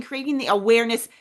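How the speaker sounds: usual speaking voice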